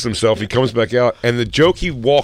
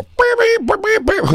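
high, tooting mock trumpet; imitated brass beeps; mouth-made trumpet noise; slower than usual. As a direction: toot a trumpet fanfare with your mouth. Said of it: "I used to play the trumpet".

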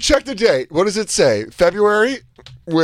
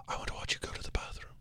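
whispered creepy line, breathy and low; 21 dB quieter, faster than usual. Retold or imitated. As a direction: hush it like a creep. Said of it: "And you go".

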